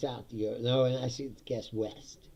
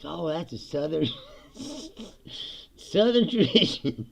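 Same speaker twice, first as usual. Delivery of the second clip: mock Southern pride; 4 semitones higher than usual. Voicing the barbecue staff.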